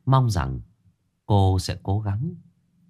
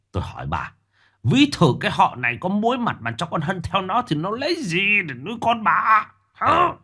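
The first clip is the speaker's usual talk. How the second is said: much higher contemptuous tirade; furious rant; 9 semitones higher than usual. Acted. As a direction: tear into her with scorn. Husband rages at pleading wife.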